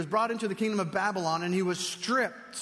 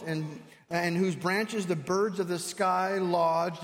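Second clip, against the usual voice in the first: preaching scripture; 3 semitones higher, 6 dB louder than usual. Acted. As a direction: proclaim the verses to the congregation. Reading scripture at full force.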